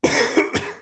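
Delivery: harsh cough into the mic; coughing fit. Real, not acted. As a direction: hack and cough. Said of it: "Are you choking?".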